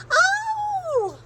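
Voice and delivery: high yelp of pain; sharp high cry of pain; yelled. Acted. Mother bitten on the nose, yelping ow. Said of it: "She yelled".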